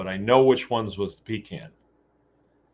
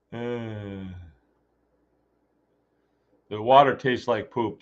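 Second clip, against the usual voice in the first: ordinary delivery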